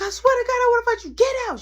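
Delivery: high, loud screaming threat; furious threat. Retold or imitated. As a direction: scream at her to get out. Tracy threatens Mary.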